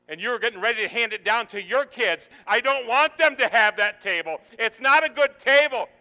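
high, very loud sermon shouting; full-force preaching. Acted. Preacher urging the congregation to give up bad tables, full-force shouting. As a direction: drive it at the congregation at the top of your voice.

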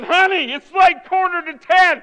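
loud, high plea to wife; retold protest. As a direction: object loudly to her late cheer. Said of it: "I said".

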